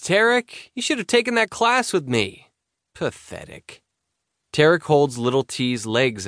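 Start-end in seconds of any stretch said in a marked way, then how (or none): 0.0-2.5 high, mocking teasing tone. Mocking friend's diaper skills. 2.9-3.8 breathy whispered put-down; whispered aside. Acted. Muttered aside at friend.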